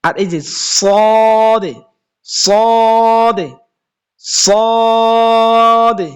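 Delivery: "সদে" stretched long with a thick, heavy s; thick sad. Shown as a demonstration. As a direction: draw the "সদে" out slow and heavy. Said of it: "আওয়াজটা একটু মোটা হবে".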